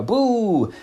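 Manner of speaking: retold boo. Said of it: "jump out and go".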